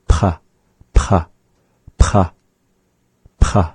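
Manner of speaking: "P" released with a raspy throat breath; breathy back P. Shown as a demonstration. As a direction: push breath from the back after each "P". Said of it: "breath with it from the back".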